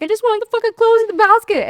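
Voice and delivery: high, loud mock exasperation; super irritated. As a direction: blow up in exaggerated irritation. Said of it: "I could be super irritated and be like".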